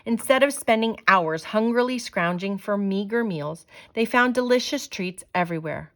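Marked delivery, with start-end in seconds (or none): none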